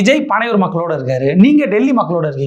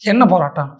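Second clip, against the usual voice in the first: usual speaking voice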